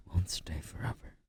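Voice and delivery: ordinary talk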